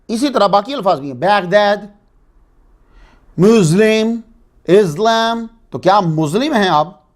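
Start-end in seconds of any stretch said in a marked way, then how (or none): 3.4-4.2 مسلم as muz-lem with a z sound; American muz-lem for مسلم; American accent. Retold or imitated. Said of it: "جب یہ American لیتے ہیں". 4.6-5.5 اسلام as iz-lam with a z sound; American iz-lam for اسلام; American accent. Retold or imitated. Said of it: "جب یہ American لیتے ہیں".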